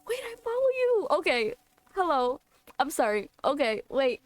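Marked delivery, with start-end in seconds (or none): 0.0-1.1 real high-pitched, delighted disbelief; starstruck squeal. Real, not acted. Streamer spots someone she follows among the visitors, starstruck squeal.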